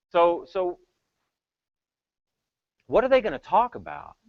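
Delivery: normal talking voice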